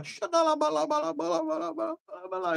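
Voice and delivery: high, soft rolling babble; rapid tongues babble. Retold or imitated. Preacher imitating his own tongues to his son, soft babble. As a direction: rattle the syllables off lightly. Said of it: "E eu fazendo assim".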